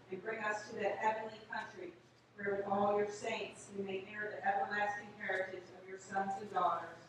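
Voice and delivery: ordinary talk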